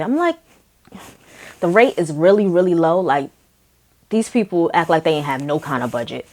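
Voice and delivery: ordinary delivery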